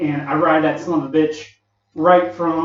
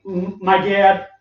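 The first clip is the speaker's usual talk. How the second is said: ordinary talk, nothing marked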